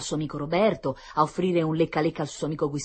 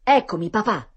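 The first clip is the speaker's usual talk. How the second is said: put-on loud, higher-pitched boyish announcement; esclamazione; 6 semitones higher, 8 dB louder than usual. A narrator's reading. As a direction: call out brightly to announce yourself. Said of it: "esclamò Tonino". (esclamazione squillante di bambino)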